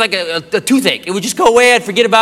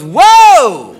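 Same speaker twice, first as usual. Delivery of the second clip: mock loud, higher whoa; startled cry; 4 semitones higher, 8 dB louder than usual. Girlfriend stopping him in alarm, startled. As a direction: imitate a startled, drawn-out whoa. Girlfriend reacts in retold scene.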